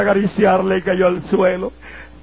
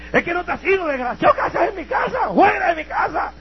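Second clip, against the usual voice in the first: much higher shrill yell; shouting mother; 11 semitones higher than usual. Retold or imitated. Mother screaming at her son to leave, enraged shout. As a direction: order him out of the house at full voice.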